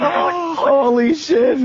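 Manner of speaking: slow, high, sobbing exclamation; awed crying shout. Real, not acted. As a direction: blurt it out overcome.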